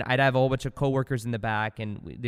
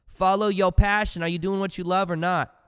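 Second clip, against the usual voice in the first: usual speaking voice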